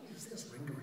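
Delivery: soft private murmur to oneself; retold thought, kept quiet; hushed aside. Acted aside to himself on stage.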